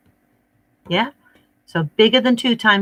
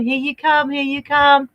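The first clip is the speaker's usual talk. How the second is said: usual speaking voice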